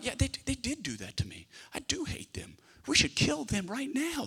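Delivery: hushed, half-whispered resentment; whispered grudge. Pretend. Voicing listener's grudging thoughts.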